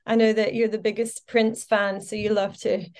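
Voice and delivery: ordinary delivery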